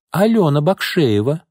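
a hint of a child's high, drawn-out answer; маленькая девочка. A narrator's reading. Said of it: "ответила девочка". (слегка детским голоском)

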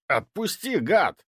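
sharp pained yell. A narrator's reading. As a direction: yell it out in pain. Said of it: "заорал он". (крик от боли)

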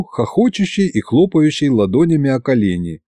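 ordinary delivery

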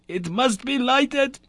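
high, slow, urgent demand; wild cry. A narrator's reading. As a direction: insist desperately. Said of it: "cried the professor wildly".

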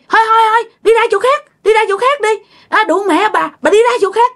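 high-pitched, relentless mock tirade; imitating angry scolding. Uncle accusing the cloth seller of a scam, angry scolding. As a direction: act out cursing her away, voice pitched high. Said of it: "Chửi quá trời chửi luôn".

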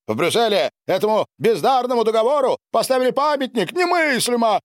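high-pitched, angry sneer; spiteful scorn. A narrator's reading. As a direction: pour out scornful indignation. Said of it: "зло усмехнулся Дональд". (злая усмешка, высокий возмущённый голос)

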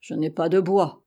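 ordinary delivery, nothing marked

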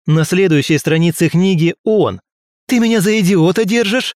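loud, high angry yell; angry shout. A narrator's reading. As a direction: shout him down in rage. Narrator yelling at ghost.

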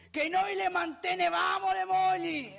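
sudden high, loud boast; sbotto indignato. Acted. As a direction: burst out in outraged pride. Sbotta a confronto dei giovani. (esclamazione forte e acuta, indignata)

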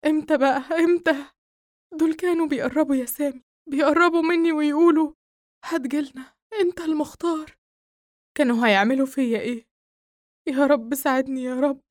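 high, loud frightened rush; distraught. Acted. Nael telling Sami about the figures, frightened and pleading. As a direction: spill out the horror breathlessly.

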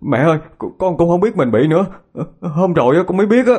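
rising panicked plea to mom; panicked wail. Acted. Son wails to his mother that he didn't know, panicked.

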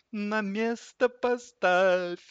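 high, slow singsong plea from a sled; sad singsong. A narrator's reading. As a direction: draw it out high, sadly sing it. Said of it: "грустно пропели санки ему вслед". (грустно, нараспев, высоким голосом)

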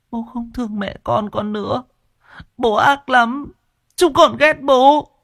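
put-on children crying at their father; whimpering children. A narrator's reading. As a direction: sob out the accusation. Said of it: "những lời mếu máo ấy".

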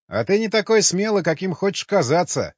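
narrated character shouting; shouted taunt. A narrator's reading. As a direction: call it out as a challenge. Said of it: "крикнул людоеду Юрий".

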